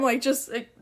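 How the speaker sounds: normal talking voice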